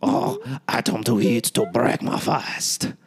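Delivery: slow, hammed-up French chef accent. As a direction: savor each word in a French accent. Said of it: "was that good?".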